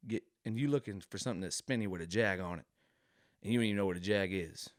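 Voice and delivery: ordinary delivery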